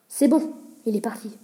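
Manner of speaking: plain reading voice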